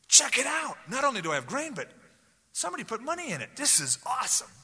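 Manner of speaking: high, thrilled "check it out" voice; bursting with glee over free money; excited. Acted. Acted excited reaction to found money.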